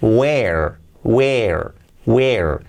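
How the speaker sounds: "where" as "wer", one syllable with sounded R; pronunciation drill. Shown as a demonstration. Teacher gives the pronunciation, "where" with clear R. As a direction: repeat "where" singly, falling, R at the end. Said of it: "la pronunciación".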